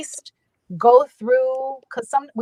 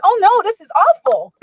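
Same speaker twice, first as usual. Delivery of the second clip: high, louder mock despair; mock horror; 10 semitones higher, 4 dB louder than usual. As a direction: groan at how bad it sounds. Judging her own recording.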